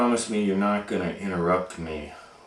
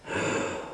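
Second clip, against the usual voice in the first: mock gasp; 5 dB quieter than usual. Dramatic gasp in the story.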